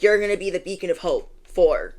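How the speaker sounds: usual speaking voice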